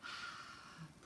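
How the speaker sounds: a long, airy breath out through the mouth; exhale sigh. Shown as a demonstration. Yoga teacher showing the kids how to exhale, with a long audible sigh. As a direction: let the breath go audibly so the class can copy it. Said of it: "and when you exhale".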